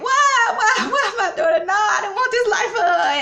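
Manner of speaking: high, loud mock wailing; screaming and crying. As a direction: imitate a distraught mother wailing. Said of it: "screaming and crying".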